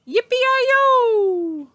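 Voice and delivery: high, loud yippee-yi-yo shout; cowboy whoop. A narrator's reading. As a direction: whoop like a cowboy waving his hat.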